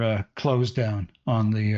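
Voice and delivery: normal talking voice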